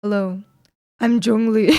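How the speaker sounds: lightly voiced deep man, straining low; Zhongli. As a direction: attempt at a deep, scary man voice. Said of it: "the deepest scary man voice you can do".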